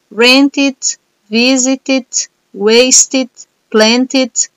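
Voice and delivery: Rent it, visit it, waste it, plant it, each ending in an extra ID syllable. Shown as a demonstration. Teacher to class, demoing the ID sound of ed endings. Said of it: "tem uma pronúncia de I D no final".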